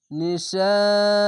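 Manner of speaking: slow chanted নিশাইল with a long stretched vowel; drawn-out recitation. Shown as a demonstration. Teacher models the madd lazim, slow chanted stretch. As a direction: stretch it four beats. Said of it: "চার আলিফ টেনে পড়বেন".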